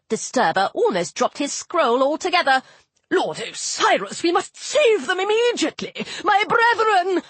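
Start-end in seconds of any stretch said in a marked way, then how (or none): 3.1-7.2 high-pitched frantic appeal; agitated fussy god; urgent plea. A narrator's reading. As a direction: implore your lord in high alarm. God pleads urgently for his brethren.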